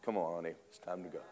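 a gentle angel welcoming her home; hushed tender voice. Retold or imitated. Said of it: "Big angel".

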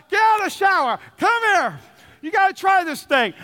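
husband hollering to wife in the shower; excited yelling. Retold or imitated. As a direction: shout it like you can't wait.

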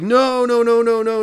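high loud protesting; imitating the group yelling to stop it; shouting no. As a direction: cut it off with loud, high noes.

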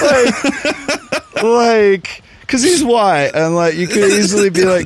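high voice, cracking up; laughing. Real, not acted. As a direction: talk on through real laughter. Cracking up at own story.

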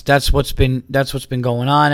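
usual speaking voice

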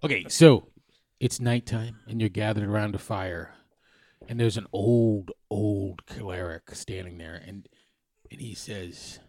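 slowing into story narration; game master narration. Acted. Dungeon master setting the campfire scene for players, slow narration. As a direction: set the scene slowly around the fire.

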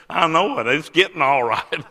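high, amused imitation of a weepy answer, breaking into laughter; mock tearful reply. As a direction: play the weepy man and laugh at yourself.

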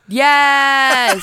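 loud, high cheer; excited cheer. Real, not acted. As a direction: whoop in delight. Cheering the roof kill.

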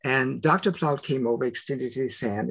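normal talking voice

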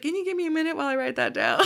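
fast and high, urgent; excited. Retold or imitated. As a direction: rush it out high and eager. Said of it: "but I was like".

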